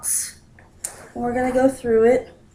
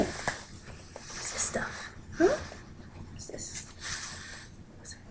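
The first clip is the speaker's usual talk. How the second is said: slow hushed whisper to a pet; 11 semitones higher, 15 dB quieter, slower than usual. Real, not acted. Owner coaxing a wary cat, slow whisper. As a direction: breathe the question so the cat stays calm.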